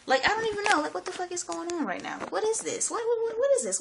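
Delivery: high-pitched, incredulous questioning; bewildered amazement. Real, not acted. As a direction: voice climbs high in baffled wonder. Overwhelmed by wonder at existence.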